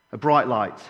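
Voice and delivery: ordinary delivery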